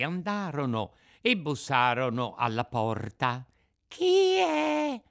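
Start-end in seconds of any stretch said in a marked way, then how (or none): 3.9-5.0 very high, loud little squeak; tiny voice. Acted. Unseen cricket answers a knock at the door, squeaky. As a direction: pipe up as a tiny creature. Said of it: "disse una vocina di dentro".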